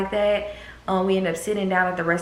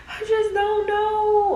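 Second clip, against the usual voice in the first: very high, slow, drawn-out whine; whiny despair; 3 dB louder, much slower than usual. Retold or imitated. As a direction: stretch it out in tearful helplessness. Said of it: "And I'm just like".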